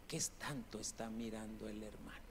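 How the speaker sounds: low, very soft aside; murmured puzzlement, kept low; hushed private wondering. Retold or imitated. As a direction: drop right down as if thinking to yourself. Private thought during the retold story.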